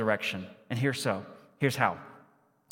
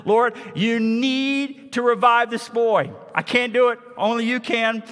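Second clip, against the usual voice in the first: Elijah praying; 8 semitones higher, 4 dB louder than usual. Acted. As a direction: beg God out loud for the boy. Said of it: "He says".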